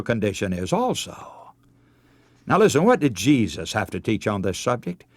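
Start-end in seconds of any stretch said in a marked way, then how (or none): none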